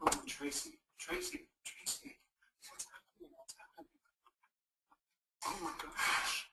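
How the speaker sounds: breathless panicked whisper; distressed whisper. Acted. Caller in crisis reaching out, panicked whisper.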